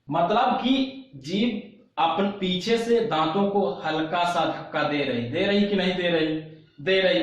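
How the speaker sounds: ordinary delivery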